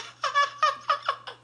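a very high imitated giggle; high girlish cackle; high mock giggle. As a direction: giggle high like the girl did. Imitates her laughing.

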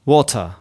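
"water" with a plosive British T; "water" with a hard, aspirated T; exploded T. Shown as a demonstration. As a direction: burst the T in "water" crisply. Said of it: "たって破裂させる".

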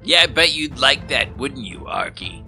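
usual speaking voice

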